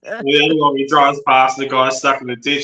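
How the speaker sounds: ordinary talk, nothing marked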